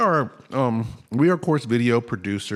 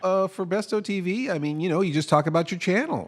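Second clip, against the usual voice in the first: high boss voice; 8 semitones higher than usual. Acted. Skit boss answers.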